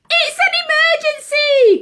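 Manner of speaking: high, loud urgent shout. Acted. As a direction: cry out the alarm, pitched high. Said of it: "cried Ozzy".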